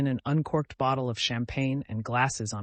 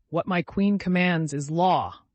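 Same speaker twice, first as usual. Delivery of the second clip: gallant; 5 semitones higher, 7 dB louder, slower than usual. A narrator's reading. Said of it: "gallantly kissing Grushenka's hand".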